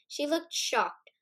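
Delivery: plain reading voice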